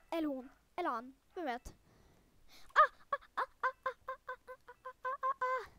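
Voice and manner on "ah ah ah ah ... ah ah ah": high, loud frightened ah ah ah; panicked whimpers. Real, not acted. Player hiding from the murderer, high scared cries. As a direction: gasp out little scared cries.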